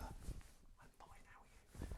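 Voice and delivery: very soft, voiceless whispered line. Shown as a demonstration. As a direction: whisper your own reply to the cue. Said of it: "And then you'd whisper".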